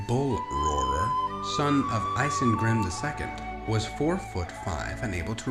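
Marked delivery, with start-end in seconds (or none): none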